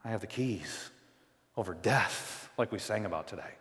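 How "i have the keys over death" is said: much softer, gentle voice of Jesus; gracious. Retold or imitated. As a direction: speak it tenderly to a fallen friend. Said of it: "so gracious".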